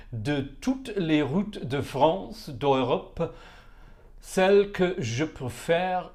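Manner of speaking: slow, deliberate French, syllable by syllable; halting French read-aloud, struggling for the rhythm. Real, not acted. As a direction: pick carefully through French lyrics, drawn out. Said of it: "I can't do it in rhythm".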